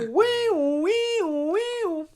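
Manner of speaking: high far-off calling. Acted.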